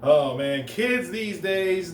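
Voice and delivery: normal talking voice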